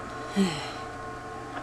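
soft, breath-only sigh. Real, not acted. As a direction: let out a quiet sigh. Player sighs while waiting.

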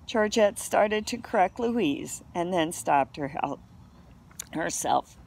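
plain reading voice

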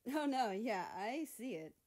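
teasing agreement; snickering. Acted. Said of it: "Greenland snickered".

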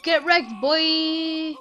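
slow, loud gloating yell; taunting shout. Real, not acted. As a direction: rub it in, drawn out. Gamer taunts a beaten enemy.

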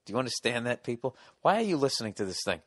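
normal talking voice

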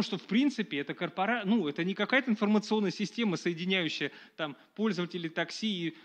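ordinary talk

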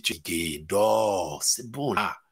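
low, soft coaxing voice; tempting voice. Retold or imitated. A tempting voice tells the tired believer, low soft coaxing. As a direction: lull them back to sleep. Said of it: "Il y a une voix qui dit".

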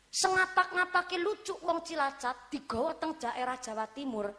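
ordinary talk

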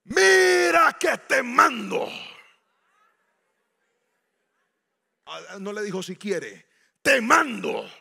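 loud, slow, forceful command; commanding shout. Acted. Dios ordena a Josué, en un grito solemne. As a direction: thunder the order like a general.

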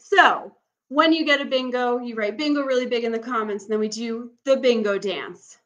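loud, eager camp-counselor energy; rally the campers with enthusiasm; excited. Real, not acted. Hyping the bingo celebration.

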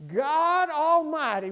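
normal talking voice

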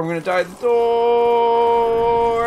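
DOOR held in a long, high wail; loud, drawn-out panic; panicked yell. Real, not acted. Last panicked repeat, then escape.

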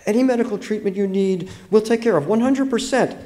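put-on high, earnest girl's voice; assuring young girl. A narrator's reading. Girl assuring the injured narrator, high and earnest. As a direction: promise it eagerly, pitched high. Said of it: "she assured me".

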